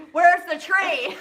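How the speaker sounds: loud, drawn-out mock demand; hammed-up loud impatience; mock exasperated demand. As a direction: demand it slowly and loudly, playing mad. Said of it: "I'm so mad at my husband".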